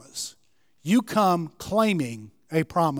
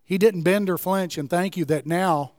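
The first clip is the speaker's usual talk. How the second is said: closing prayer; 2 semitones lower than usual. Acted. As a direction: pray gently for the congregation.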